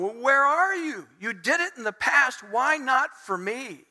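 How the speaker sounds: high, drawn-out plea to God; psalmist's protest. Acted. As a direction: demand an answer, aggrieved. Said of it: "the psalmist is going".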